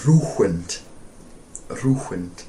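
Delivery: ruined with an h breathed in the middle, like ruhwined; Icelandic-accented ruined. Retold or imitated. Recalls how an Icelander once said ruined, Icelandic accent. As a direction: mimic an Icelander saying ruined. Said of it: "someone in Iceland said".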